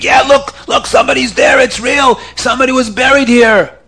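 high excited shouting at the grave; screaming crowd. Acted. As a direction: yell it out, a crowd that found a body. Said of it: "And people start screaming".

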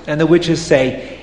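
usual speaking voice

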